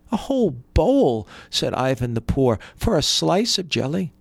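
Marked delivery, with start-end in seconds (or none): 0.1-1.3 higher pitched disbelieving echo; incredulous. A narrator's reading. Poor brother repeating in disbelief. 2.8-4.1 high rising disbelieving question. A narrator's reading. Poor brother's disbelieving question.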